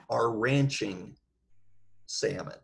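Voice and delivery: ordinary delivery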